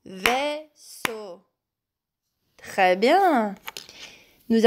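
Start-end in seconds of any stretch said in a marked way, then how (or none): 0.0-1.4 Vaisseau softly split into two clapped beats, vais-seau; clapping syllables. Shown as a demonstration. Teacher clapping out Vaisseau so pupils can listen. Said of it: "Répétez avec moi".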